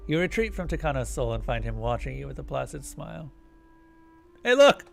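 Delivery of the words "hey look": excited shout. Acted. Sudden excited exclamation.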